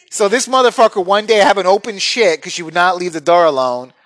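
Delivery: normal talking voice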